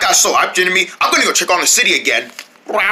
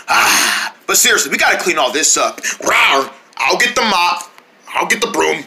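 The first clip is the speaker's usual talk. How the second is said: normal talking voice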